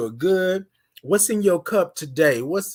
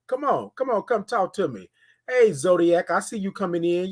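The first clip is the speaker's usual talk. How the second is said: ordinary talk, nothing marked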